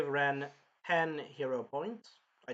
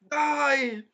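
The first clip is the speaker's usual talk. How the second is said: high, loud drawn-out shout; mock murderous cry; imitated battle cry; 10 semitones higher, 9 dB louder than usual. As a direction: yell it like a killing blow. Acting out gleeful stabbing with shout.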